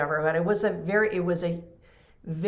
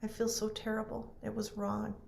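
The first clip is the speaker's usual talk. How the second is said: a softer hint of the nurse's sobbing apology; tearful apology, softer; 3 semitones higher, 9 dB quieter than usual. Nurse apologising to the widow, tearful and quiet. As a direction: let the apology sink quietly, barely holding together. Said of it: "she's bawling her head off".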